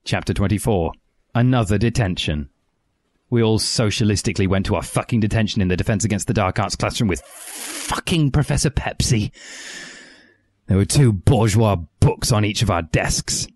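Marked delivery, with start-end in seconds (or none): none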